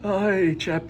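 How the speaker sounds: high mock-British exclamation; British accent. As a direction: play a hammed-up British chap, pitched high. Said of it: "That's my best British accent I can do".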